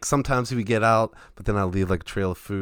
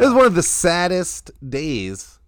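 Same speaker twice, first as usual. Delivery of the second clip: tearing up; 8 semitones higher, 6 dB louder, slower than usual. Real, not acted. Telling a friend about his dead hamster, close to tears. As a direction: voice lifting with held-back tears. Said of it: "it's making me tear up right now".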